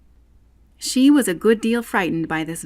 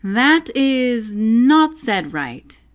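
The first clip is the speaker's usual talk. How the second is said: caterpillar's slow, louder, firm verdict; decided, slow; 5 semitones higher, 6 dB louder, much slower than usual. A narrator's reading. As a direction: lay it down slowly and firmly. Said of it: "said the caterpillar decidedly".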